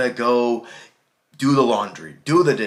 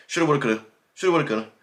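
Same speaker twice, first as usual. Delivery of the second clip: fast slurred shoulda woulda coulda; 4 semitones higher, much faster than usual. Shown as a demonstration. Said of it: "we talk so fast and we just say".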